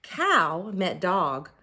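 ordinary delivery

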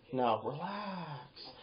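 very slow, soft coaxing; gentle, drawn-out soothing lilt; melodical tone. Shown as a demonstration. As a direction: stretch it out sweetly and quietly. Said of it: "melodical tone".

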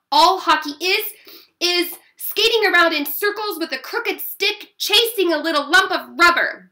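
loud, high scornful rant; bellowing. A narrator's reading. Sister mocks brother's hockey, bellowing. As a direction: bellow a sibling put-down. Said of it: "I bellowed".

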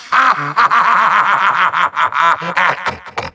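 long rattling villain cackle; evil laugh. Acted. Villain unleashes evil eruptor, long maniacal cackle. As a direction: let a maniacal laugh run out of steam.

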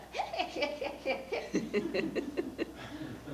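high witchy cackle; long, staccato "hee hee hee"; witch laugh. Acted. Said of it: "Carol gave her witch laugh".

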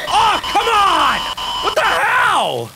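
high, drawn-out protest; exasperated yelling. Real, not acted. Player caught by tentacles, exasperated yelling. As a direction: wail your complaint at the game.

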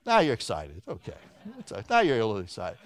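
low, deadpan remark to the crowd; low-key, amused acknowledgement; teasing aside. Real, not acted. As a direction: drop right down and tease them drily. Teasing audience after rousing them.

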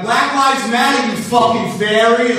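loud, high, aggressive Boston yell; Boston hate-speech voice. Retold or imitated. Comic yelling a slogan like an angry Boston guy. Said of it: "They're like".

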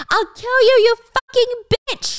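high, loud furious scream; acted rage, high and loud; retold scream. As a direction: yell it at her through the locked door. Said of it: "Danny's screaming".

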